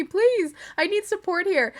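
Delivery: high, pleading mock shriek; mock desperate scream. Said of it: "I feel like she's just screaming at her".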